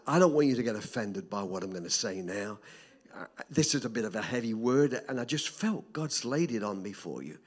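ordinary talk

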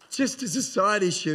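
ordinary talk

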